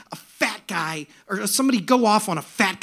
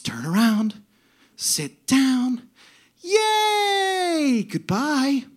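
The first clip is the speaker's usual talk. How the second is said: sweet angel's singsong, lifting higher; angelic, joyful; 6 semitones higher, 4 dB louder, much slower than usual. Retold or imitated. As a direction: chant it like a delighted angel greeting riders. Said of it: "and it was joyful".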